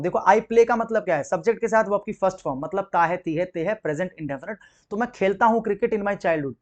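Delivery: normal talking voice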